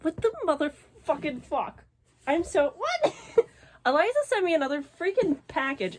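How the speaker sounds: usual speaking voice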